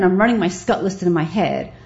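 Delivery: normal talking voice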